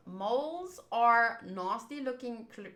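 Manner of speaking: ordinary delivery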